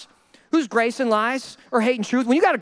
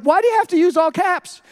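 higher-pitched mock complaint; exasperated protest; 4 semitones higher, 3 dB louder than usual. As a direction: plead with a boss who shouts by text. Employee protesting all-caps texts.

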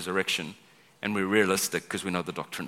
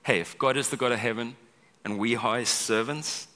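usual speaking voice

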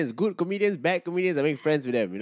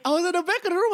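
ordinary talk

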